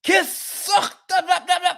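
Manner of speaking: high, put-on mock coach voice; a hammed-up coach yelling gibberish at his players; mock coach in Arabic. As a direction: ham up an angry coach barking in Arabic. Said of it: "imagine if he does it in Arabic".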